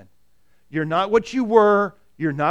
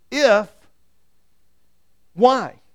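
emphatic; 3 semitones higher, 7 dB louder than usual. Shown as a demonstration. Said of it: "The question is".

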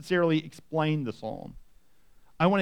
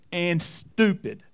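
drawn-out wry punchline; slow deadpan; much slower than usual. Real, not acted. Pastor ending his list about sheep, slow and wry. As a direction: land the last word slowly for a laugh.

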